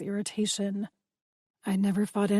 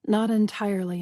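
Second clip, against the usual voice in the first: ordinary delivery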